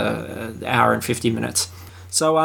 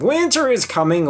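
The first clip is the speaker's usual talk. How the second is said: mock ominous tagline; 10 semitones higher than usual. Quoting Game of Thrones tagline.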